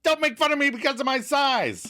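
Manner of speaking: high-pitched indignant scolding. Retold or imitated. Katie standing up to Joey, scolding. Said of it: "she stands up to scold him".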